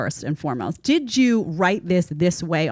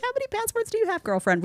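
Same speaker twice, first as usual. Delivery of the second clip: put-on sassy girlfriend voice; 3 dB quieter than usual. Mock-asking friend in sassy voice.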